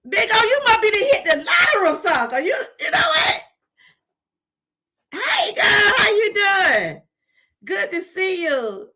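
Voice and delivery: high, loud, thrilled hello; shouting with delight at a friend who tipped; excited greeting. Real, not acted. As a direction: greet him with big excitement.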